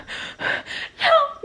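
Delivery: big high-pitched airy gasp; shocked gasp. Real, not acted. Reader who is not okay, big shocked gasp. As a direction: gasp long in disbelief. Shocked by what she reads.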